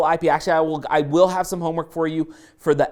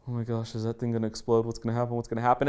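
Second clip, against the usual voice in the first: low, hushed, hurried worry to yourself; mock worried muttering; 6 semitones lower, 7 dB quieter, much faster than usual. Driver eyeing a flimsy cup at the window, quick hushed worry. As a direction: fret quickly under your breath.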